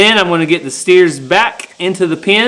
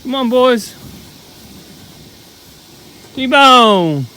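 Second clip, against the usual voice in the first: calling out; 8 semitones higher, 4 dB quieter, slower than usual. Real, not acted. Calling steers across the pasture.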